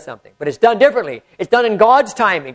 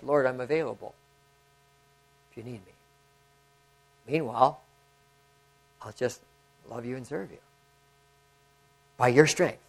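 soft, much quieter retold prayer to the Lord; hushed prayer; 5 semitones lower, 11 dB quieter than usual. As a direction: pray it gently, almost under your breath. Prayer voiced in retelling.

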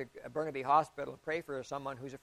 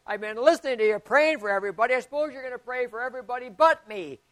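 higher, louder sassy demand; brash woman; 7 semitones higher, 11 dB louder than usual. Retold or imitated. Brash patient demanding the pastor's attention, loud and high. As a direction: snap back like a pushy patient.

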